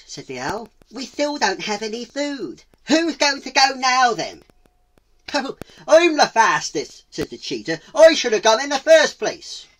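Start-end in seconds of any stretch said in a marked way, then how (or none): 0.9-4.4 loud, high owl voice; raised, high-pitched complaint; indignant owl. Acted. Owl demands a new volunteer. 5.3-7.0 loud, high, eager boast; volunteer as the cocky cheetah; big high-pitched show-off voice; boastful cheetah. Acted. 7.9-9.6 loud, high, eager boast; volunteer as the cocky cheetah; big high-pitched show-off voice; boastful cheetah. Acted.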